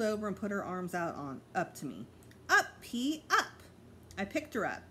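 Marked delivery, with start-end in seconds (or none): 2.5-3.6 put-on high eager little child calling up; toddler voice. A narrator's reading. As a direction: reach up and beg to be carried. Toddler asking to be lifted.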